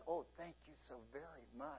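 soft, quick grateful thanks; thanking the clerk kindly; sweetly thankful. Retold or imitated. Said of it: "asking nice".

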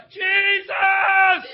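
very high, long drawn-out shout. Retold or imitated. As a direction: cry the name out at full stretch. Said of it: "he yelled even louder".

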